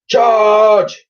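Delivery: high, loud rallying shout; shouted charge. Acted. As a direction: lead the charge with a yell. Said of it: "shouted the Admiral".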